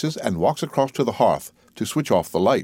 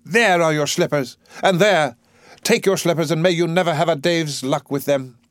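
higher, forceful angry voice; wrathful, pitched up; furious outburst; 6 semitones higher, 4 dB louder than usual. Acted. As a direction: hurl the words at him. Eliza hurls slippers at Higgins.

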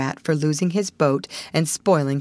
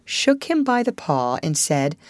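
plain reading voice

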